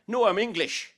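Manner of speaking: high, loud mock insistence; mock Irish gran. Grandson mimics gran insisting she's English, high and loud.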